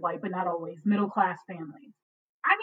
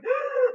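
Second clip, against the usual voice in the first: sharp shocked gasp; 14 semitones higher than usual. Real, not acted. Shocked at friend's remark.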